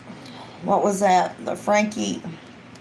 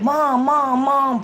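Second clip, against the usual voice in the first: put-on high whining child calling mom; little kid nagging mom; whiny kid; 5 semitones higher, much slower than usual. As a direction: tug at mom's shirt with your voice. Kids pestering mom.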